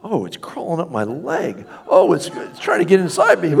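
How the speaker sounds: hammed-up horror victim feeling something crawl up his leg; growing mock terror, ending in a loud high cry; mock sci-fi panic. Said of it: "It's more like a sci-fi movie".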